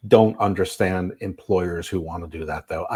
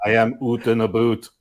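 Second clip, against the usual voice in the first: put-on playful Canadian accent on oot and boot; mock Canadian accent. Joking about the Canadian Mountie.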